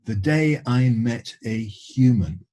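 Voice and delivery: usual speaking voice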